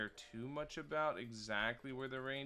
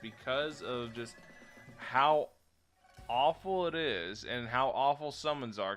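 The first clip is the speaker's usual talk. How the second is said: emphatic rant; 4 semitones higher, 10 dB louder, slower than usual. Real, not acted. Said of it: "ranting about mechanic".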